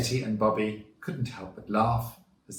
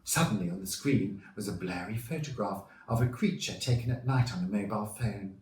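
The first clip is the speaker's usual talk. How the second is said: usual speaking voice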